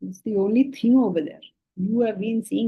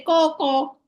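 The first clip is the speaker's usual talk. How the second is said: higher, playful mock caw; crow caw imitation; 7 semitones higher than usual. Teacher imitating a crow for the class, playful caw. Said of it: "the crow will come and say".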